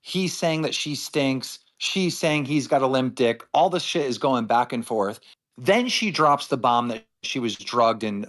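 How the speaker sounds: usual speaking voice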